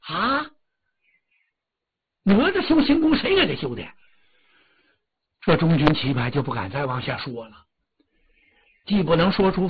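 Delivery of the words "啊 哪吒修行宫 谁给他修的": shocked outburst. Acted. Li Jing learns his dead son has a temple, asking in high shocked disbelief. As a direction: pitch leaps high in disbelief.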